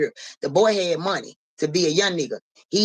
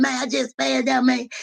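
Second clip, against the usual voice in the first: mock crying excuse; 7 semitones higher than usual. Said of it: "He crying".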